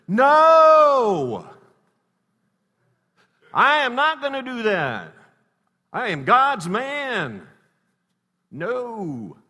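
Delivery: a pious husband's over-the-top loud protest; mock righteous shout. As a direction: bellow the refusal like a proud holy man. Husband refuses the fruit in story.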